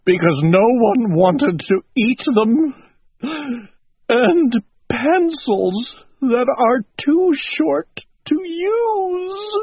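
tearful voice climbing into a high wail; crying. A narrator's reading. Owl fills the kettle with tears, high wail. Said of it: "he cried".

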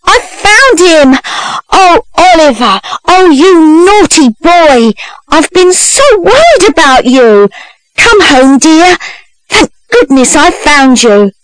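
high, theatrical cries of relief; crying out. A narrator's reading. Young woman claiming her runaway brother, high relieved cries. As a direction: play the overwrought sister, pitched high. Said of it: "but cried".

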